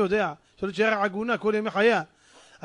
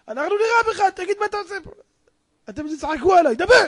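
high, loud acted threats; angry interrogation; 10 semitones higher, 8 dB louder, faster than usual. Soldiers demand that the intruder talk, angry interrogation. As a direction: bark orders at a prisoner.

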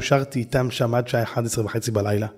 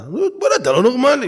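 higher, louder, fast protest; exasperated protest; 10 semitones higher, 5 dB louder than usual. Retold or imitated. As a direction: push back in disbelief. Speaker protests to salesman. (מחאה נרגשת בקול גבוה)

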